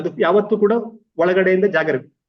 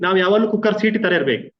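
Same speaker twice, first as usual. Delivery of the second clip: usual speaking voice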